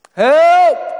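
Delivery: high, loud, desperate shout; a beggar calling out over a crowd; desperate cry for help. Acted. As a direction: cry out like a blind beggar begging to be heard. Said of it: "En daarom roept hij".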